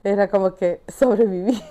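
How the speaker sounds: usual speaking voice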